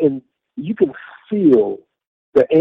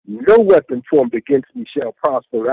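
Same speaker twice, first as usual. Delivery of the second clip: retold holler; 10 semitones higher than usual. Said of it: "I hollered at the devil".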